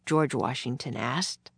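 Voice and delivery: ordinary delivery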